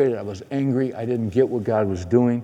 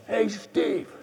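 calling out from down a hallway; 11 semitones higher, 4 dB quieter than usual. Retold or imitated. Man calls out in hallway.

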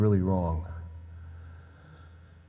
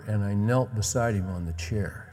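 usual speaking voice

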